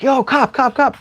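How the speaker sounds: high, urgent call repeated; retold calling out. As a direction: hail the cop, urgent. Calling the cop over.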